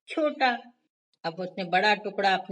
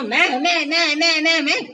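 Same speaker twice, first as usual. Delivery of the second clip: high, loud squeaky me-me bickering; squabbling me me; 6 semitones higher, 7 dB louder than usual. A narrator's reading. Two mice squabbling over bread, high and loud. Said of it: "झगड़ने लगी".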